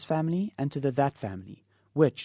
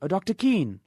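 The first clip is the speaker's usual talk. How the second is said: calling out again; 7 semitones higher, 4 dB louder than usual. Acted. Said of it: "he called".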